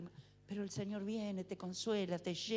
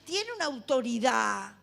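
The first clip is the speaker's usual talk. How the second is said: ordinary talk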